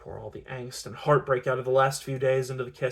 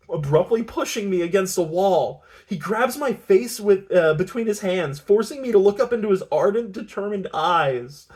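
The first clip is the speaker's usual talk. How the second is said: loud, high, swelling urgency; dramatic narration; 7 semitones higher, 7 dB louder than usual. A narrator's reading. Narrator telling of being pushed against a wall, dramatic and urgent. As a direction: push the story to an impassioned peak. Narrator builds up the abrupt embrace.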